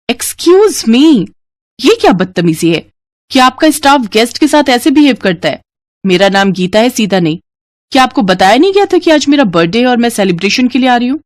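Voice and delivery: a spoilt guest putting staff in their place; haughty rich girl. Acted. Geeta scolding the hotel staff, haughty and indignant.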